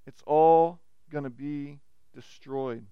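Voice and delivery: low, slow and grave; Jesus's low, slow solemn warning. Retold or imitated. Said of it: "Jesus says".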